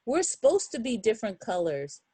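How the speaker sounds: ordinary delivery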